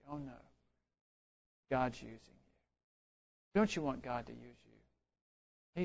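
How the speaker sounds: low, very soft, unhurried comfort; tender reassurance. Retold or imitated. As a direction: reassure gently in a hushed low voice. Retold tender reassurance from God.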